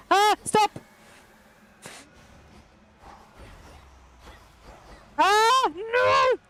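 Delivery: high, loud scream at the boss; real frantic yell; panicked scream. Real, not acted. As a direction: scream for it to stop.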